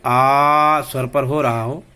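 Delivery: आ held long and slow; drawn-out aa. Shown as a demonstration. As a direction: stretch आ like a teacher showing the sound. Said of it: "उच्चारण करते समय उनका अंत आ".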